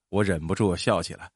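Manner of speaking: usual speaking voice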